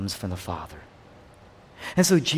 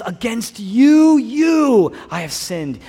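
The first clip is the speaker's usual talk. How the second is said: impassioned cry, loud and drawn out, then dropping; weeping repentance; 7 semitones higher, 7 dB louder, much slower than usual. Acted. King David praying in repentance, crying out loud and high. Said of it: "He's crying, he's weeping, he's repentant".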